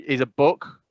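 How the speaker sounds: ordinary delivery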